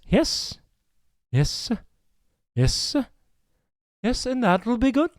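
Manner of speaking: ordinary delivery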